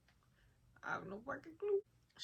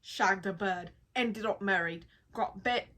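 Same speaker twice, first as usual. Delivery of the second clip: a loose, hammed-up British accent, a bit louder; 7 dB louder than usual. Reading a UK Christmas party story in a mock British accent. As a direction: channel your inner Harry Potter with a rough British accent. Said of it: "mimic the accent".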